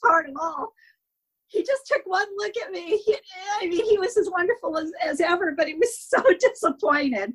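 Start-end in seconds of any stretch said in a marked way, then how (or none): 1.5-4.3 on the verge of crying as she remembers; tearful. Real, not acted. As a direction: voice catching with tears, pitched up. Saddest part, remembering him.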